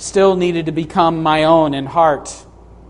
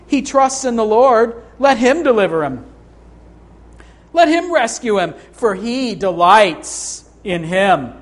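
high-pitched sarcastic taunt, slowing on the last words; 5 semitones higher than usual. Acted. Mockers jeering at the man who trusts God, sarcastic. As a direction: sneer it as a mocking jeer. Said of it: "you hear the sarcastic tone".

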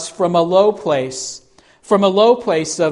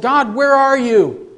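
high, anguished cry to God; Habakkuk pleading; 6 semitones higher than usual. Retold or imitated. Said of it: "and said".

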